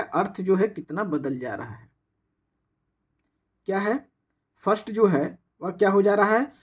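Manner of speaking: normal talking voice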